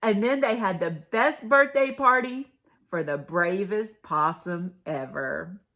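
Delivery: plain reading voice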